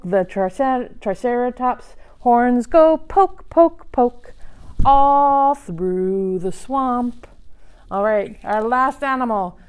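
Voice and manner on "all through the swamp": slow drawn out. Pretend. Slowed end of chant.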